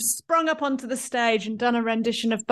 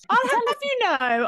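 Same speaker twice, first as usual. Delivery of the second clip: mock indignant; 13 semitones higher than usual. Mock indignant retort to teasing.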